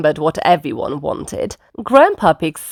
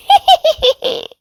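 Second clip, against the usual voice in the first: very high acted laughter; high piglet giggle; 4 dB louder than usual. A narrator's reading. Pigs jumping in mud, very high giggling. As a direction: giggle like delighted little pigs.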